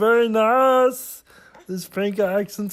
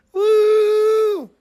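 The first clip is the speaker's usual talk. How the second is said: long high-pitched woo; high whoop; 11 semitones higher, 4 dB louder than usual. Real, not acted. Vlogger still doing eyeliner, high whoop. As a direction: hold a high cheering woo. Cheers own makeup.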